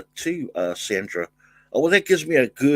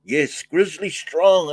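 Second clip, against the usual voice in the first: higher, louder and slow, showing it off; proud announcement; 7 semitones higher, 5 dB louder, slower than usual. Real, not acted. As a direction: announce the shirt like a presenter. Shows off his new t-shirt.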